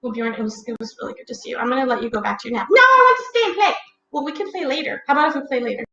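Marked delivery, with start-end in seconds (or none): 2.7-3.7 put-on high, fast kid begging to stay; pleading child voice. Puppet boy begs the storyteller to keep playing, high and pleading. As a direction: whine like a small boy who won't nap.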